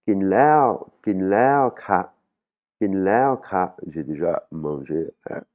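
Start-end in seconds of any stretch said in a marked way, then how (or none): 0.1-3.8 "Kinlao" with "lao" swung up high, then dropping off; high rising tone. Shown as a demonstration. Teacher models "already eaten" for students, high tone on "lao". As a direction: push "lao" up sharply in "kinlao ka". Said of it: "une intonation qui monte".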